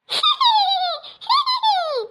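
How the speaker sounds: shrill-pitched witchy cackle; high cackle. Acted. Daughter-in-law cackles at mother-in-law.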